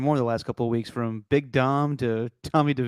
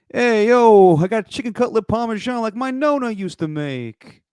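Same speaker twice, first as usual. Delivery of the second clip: high-pitched, hammed-up Italian-American accent; 9 semitones higher, 5 dB louder than usual. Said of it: "More stereotypical".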